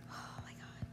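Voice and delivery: soft whispered despair. Real, not acted. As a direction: breathe it out without voice. Gamer frustrated by game.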